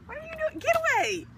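pushing the pet off; high protest. Real, not acted. Pet attacking her.

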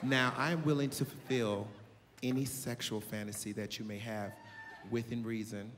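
calm and in total control; grown woman voice. Retold or imitated. Voicing the older woman.

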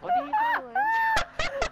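high squealing laugh through the words. Real, not acted. Friend laughing at the teammate's complaint, high squealing laugh. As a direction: crack up while asking.

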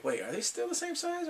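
high confused question; high bemused question; puzzled surprise. Retold or imitated. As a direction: squeak it out doing a double take. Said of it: "And I'm like".

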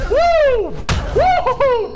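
very high, loud "woo"; excited whoops. Real, not acted. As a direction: whoop it up mid-fight. Celebrating in the fight.

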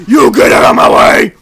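very loud full-throated yell; explosive game-rage shout. Real, not acted. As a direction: bellow it at an enemy. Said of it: "Faut peut-être que j'arrête de gueuler".

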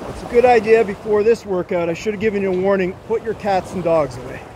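ordinary delivery

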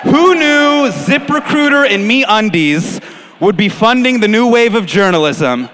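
loud, high rallying cry; hammed-up victorious proclamation; mock triumph. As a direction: crow it to the room, stretched out. Mock triumphant crowing over podcast sponsors.

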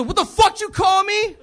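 hammed-up angry comeback at the girl; mock angry shout. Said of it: "I got pissed off at her".

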